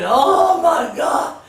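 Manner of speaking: drawn-out, high, loud wail of pain; mock screaming. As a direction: wail it slowly in hammed-up agony. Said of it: "he's crying and moaning and screaming".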